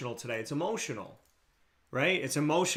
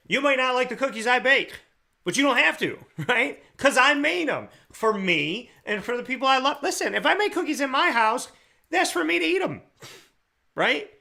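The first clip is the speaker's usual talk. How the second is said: higher, louder playful rant; excited; 9 semitones higher, 4 dB louder than usual. Real, not acted. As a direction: bubble over with amused conviction about the cookies. Playful cookie analogy rant.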